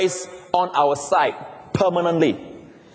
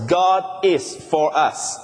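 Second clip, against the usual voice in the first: each word weighed, slowly; slow emphatic; much slower than usual. Shown as a demonstration. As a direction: lay the four words down one by one. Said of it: "four words".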